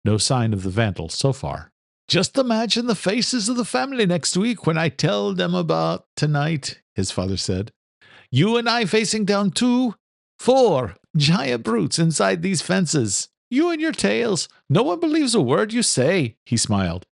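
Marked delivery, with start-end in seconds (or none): 2.1-6.8 lightly voiced Irish father, dry; Irish brogue, amused correction; a hint of an Irish father. Father's voice in story. 8.3-16.4 put-on higher young man, fondly teasing; higher voice, affectionate mockery; young son teasing. Son's voice in story.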